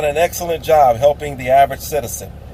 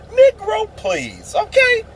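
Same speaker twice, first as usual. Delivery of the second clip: much higher incredulous scoff; scoffing dismissal; slower than usual. Real, not acted. Scoffing at rappers' endorsements to his viewers, high incredulous scoff.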